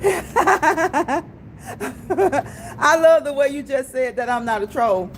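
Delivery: laughing through the words; can barely get it out for laughing; real giggles breaking the line. Real, not acted. Cracking up at chat message.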